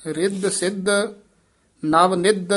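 "ਰਿੱਧ ਸਿੱਧ" and "ਨਵ ਨਿੱਧ" as separate pairs; short checked pause. Shown as a demonstration. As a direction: halt briefly after "ਨਿੱਧ". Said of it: "ਇੱਥੇ ਠਮਕ".

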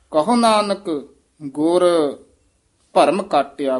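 ਗੁਰ standing alone between pauses; pauses around word. Shown as a demonstration. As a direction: leave a clear gap after ਗੁਰ before ਭਰਮ. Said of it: "ਗੁਰ ਤੋਂ ਬਾਅਦ ਵੀ ਥੋੜ੍ਹਾ ਜਿਹਾ ਠਹਿਰਾ".

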